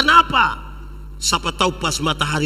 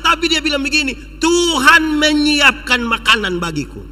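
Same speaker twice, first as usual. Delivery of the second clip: raised, high-pitched sermon delivery; preacher proclaiming with force; emphatic preaching; 9 semitones higher, 4 dB louder than usual. Real, not acted. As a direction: press the message home to the congregation. Preaches the point of the story.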